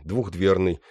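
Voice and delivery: ordinary delivery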